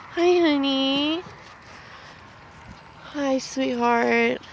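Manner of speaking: drawn-out gentle hello; tender greeting. Real, not acted. Mother cooing hello to the calves, soft and slow.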